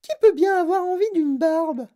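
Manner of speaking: put-on high, loud spoilt girl's voice; disgusted girl. A narrator's reading. Veruca scoffing at the beard candy.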